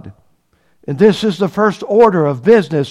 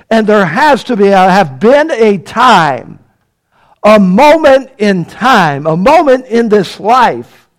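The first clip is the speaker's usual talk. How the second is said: louder, higher preaching, slowing on a moment in time; full-force preaching; 4 semitones higher, 5 dB louder than usual. Acted. Preacher pleads with the congregation in full preaching force. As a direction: drive the gospel home from the pulpit.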